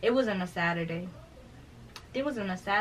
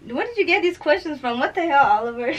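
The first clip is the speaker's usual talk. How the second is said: incredulous outburst; 8 semitones higher, 12 dB louder than usual. Real, not acted. Shocked by a heavy question.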